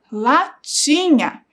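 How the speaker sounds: latinha drawn out slowly, syllable by syllable; slow syllable reading. Shown as a demonstration. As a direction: stretch latinha out for young readers. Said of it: "Nós temos a palavra".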